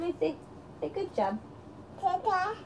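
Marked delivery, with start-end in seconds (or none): none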